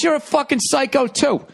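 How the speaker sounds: high-pitched imitated driver retort; mock irate driver shout; yelling back. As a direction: fire it back at top pitch.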